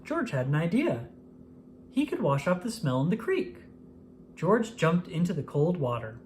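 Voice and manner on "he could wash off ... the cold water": ordinary delivery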